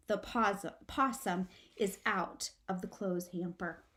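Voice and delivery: plain reading voice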